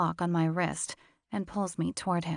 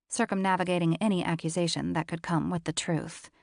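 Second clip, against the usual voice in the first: usual speaking voice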